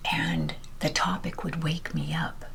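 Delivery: ordinary talk, nothing marked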